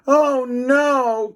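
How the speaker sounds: high, loud scared cry; boy chased by a dog. A narrator's reading. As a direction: yelp in fright. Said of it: "cried Cheese Ball".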